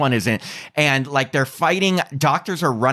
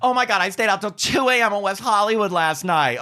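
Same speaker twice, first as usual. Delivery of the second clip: higher campy friend impression; impressionist; 6 semitones higher than usual. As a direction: gush it like your friend. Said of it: "this is me doing you".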